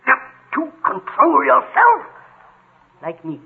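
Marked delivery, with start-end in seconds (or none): 0.1-2.0 high, slow, straining command; slow, high-pitched exasperated order; exasperated outburst. Acted. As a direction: lose control while demanding self-control. Yells while demanding self-control.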